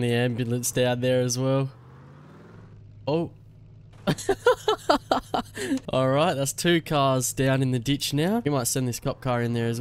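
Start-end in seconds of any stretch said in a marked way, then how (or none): 4.1-5.9 high giggling burst; high cackle. Real, not acted. Streamer laughing as cars pile in the ditch, high giggle.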